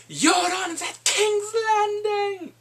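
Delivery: high, slow, drawn-out exclaim; stretched-out thrilled shout; excited announcement. Real, not acted. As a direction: announce it like big news. Excited reveal in recap.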